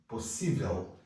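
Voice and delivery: usual speaking voice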